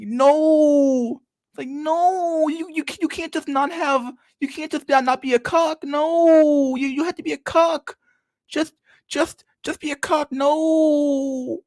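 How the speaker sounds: Jordan Peterson impression. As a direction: hammer each "no" like a scolding guru. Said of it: "Jordan, you're killing me".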